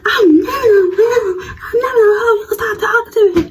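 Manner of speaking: high giggly imitation; laughing mock rival. Rival telling her to stop, mocked with laughter. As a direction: play her protest while laughing.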